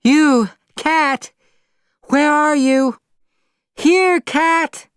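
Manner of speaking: very high, loud calls down the street; calling out. Acted. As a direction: call desperately for the lost cat. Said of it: "Holly was shouting".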